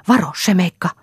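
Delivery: high, loud warning cry; cried-out warning. A narrator's reading. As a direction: shout a sudden alarm to the one in danger. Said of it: "Silloin parkaisee Anja". (korkea, kova varoitushuuto)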